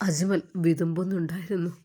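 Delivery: ordinary delivery, nothing marked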